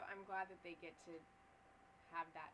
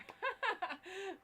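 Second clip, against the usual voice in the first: high, loud laugh at the joke; burst of laughter; 11 dB louder than usual. Real, not acted. Fan laughing at "Don't drink and vibe", loud and high.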